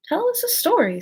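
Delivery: high, loud call; loud demand. A narrator's reading. March Hare demands a story.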